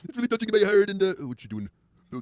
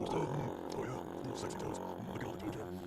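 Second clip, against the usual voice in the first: deep, soft, growled; very deep grunting Randy; 16 dB quieter, slower than usual. Acted. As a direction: rumble low like a brute. Randy licking toes.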